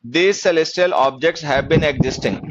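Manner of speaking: very slow, word by word dictation. Shown as a demonstration. Teacher dictating slowly to students, word by word.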